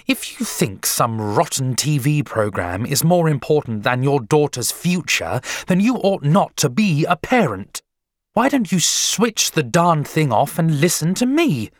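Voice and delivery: exasperated outburst, climbing in pitch; losing patience. A narrator's reading. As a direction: build to a sharp, loud scolding. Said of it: "began to lose patience".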